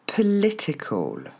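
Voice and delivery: POLITICAL as "pe-LÉ-té-col", slowly; high LI in "political", falling "ol"; neutral first vowel. Shown as a demonstration. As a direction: model "political" with "pe", not "po". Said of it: "c'est pas po, c'est un E au début".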